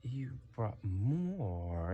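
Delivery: drawn-out, doubting question; incredulous guard. Acted. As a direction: stretch the words slowly in mild disbelief.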